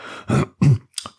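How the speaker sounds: a tense, awkward clearing of the throat; nervous throat clearing. A narrator's reading. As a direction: clear your throat like a servant caught out. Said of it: "cleared his throat nervously".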